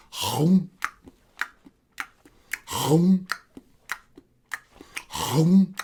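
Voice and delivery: drawn-out imitated chomp, spaced apart; peanut-munching noise. Storyteller showing elephant eating peanuts, imitated munching noise. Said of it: "eating some peanuts like this".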